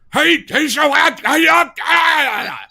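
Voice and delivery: high, loud mock-German shouting; angry mock German. Shown as a demonstration. Same dinner invitation in German, shouted angrily as parody. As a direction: rant it like furious parody German. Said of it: "Why are these people so angry".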